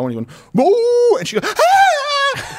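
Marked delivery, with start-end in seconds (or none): 0.5-1.2 high, loud "ooo" howl imitation; imitated dog howl. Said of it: "that mouth becomes like an O". 1.6-2.6 high, loud mock shriek; imitated frightened scream. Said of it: "thought she was gonna die".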